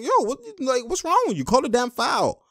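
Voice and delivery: high-pitched mock protest; imitated arguing. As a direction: act out a player arguing with the ref. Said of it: "you see him arguing with him".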